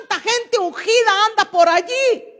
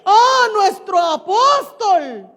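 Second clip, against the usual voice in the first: slow, drawn-out tearful wail, hammed up; mock weeping; 4 dB louder, slower than usual. Preacher mimics weeping followers of a jailed leader, mock-tearful wail. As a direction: imitate a devotee crying out, dragging the words. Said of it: "está la gente llorando y dice".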